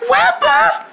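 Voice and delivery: high calling shout, close to tears. Acted. Mother cricket searching for her lost daughter, high tearful calls. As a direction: call frantically for your lost child.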